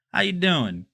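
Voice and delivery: badly faked New York accent; attempted New York accent. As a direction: try a New York accent, not quite getting it. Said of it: "I'm trying to do the New York accent".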